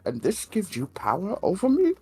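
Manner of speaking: lightly voiced mock villain; Bane. Worker questioning the new supervisor, hint of Bane voice. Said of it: "Bane quotes are usable".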